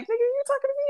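very high-pitched squirrel voice; comic squirrel, high and incredulous. Acted. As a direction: squeak it in mock disbelief. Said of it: "He was like".

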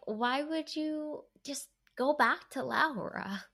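exasperated plea. Retold or imitated. Co-host recalls pleading with the film's character, exasperated. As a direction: plead slowly in mock disbelief. Retelling her reaction while watching.

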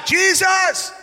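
high shouted call of the name; a blind beggar crying out to Jesus. Acted. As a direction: call out to him across the crowd. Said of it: "So he begins to cry".

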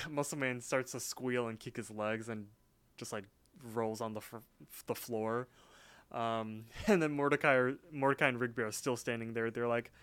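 usual speaking voice

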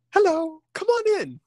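put-on very high, sweet child voice; bright little girl welcoming a guest. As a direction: pipe it up like an eight-year-old girl. Little girl greets at the door.

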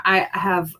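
ordinary talk, nothing marked

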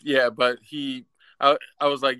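ordinary delivery

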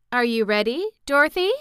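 a queen asking kindly; lightly voiced Ozma. A narrator's reading. Ozma asking Dorothy if she is ready, lightly voiced ruler.

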